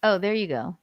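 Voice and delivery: normal talking voice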